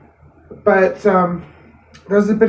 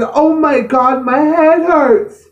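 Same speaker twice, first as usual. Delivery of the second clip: pained mother; 7 semitones higher, much slower than usual. Retold or imitated. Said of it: "She grabbed her head and said".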